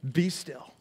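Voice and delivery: soft, understated command to a storm; calm command. Retold or imitated. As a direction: quietly still the wind and waves. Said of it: "looks at the winds and the waves and says".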